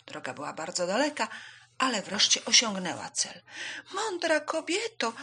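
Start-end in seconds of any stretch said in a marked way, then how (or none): 3.9-5.1 high, slow bird call; high little swallow voice; chirping, losing breath. A narrator's reading. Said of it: "zaćwierkała, tracąc oddech".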